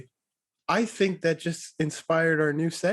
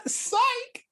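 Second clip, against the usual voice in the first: very high squeal; squeaky psych. Retold or imitated. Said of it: "And I'm like".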